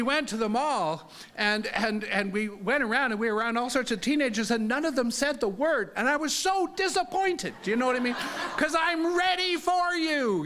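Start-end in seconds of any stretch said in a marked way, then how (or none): 6.0-10.4 mock eager. Eager for a fight at the mall.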